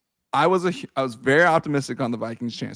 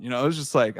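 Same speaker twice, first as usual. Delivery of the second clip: ordinary talk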